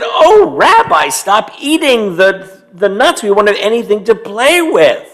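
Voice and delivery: high squeak of alarm, then scolding; mock panic over the nuts; alarmed old lady. Old lady to the bear eating nuts, high mock alarm. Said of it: "Bobby Bryna said".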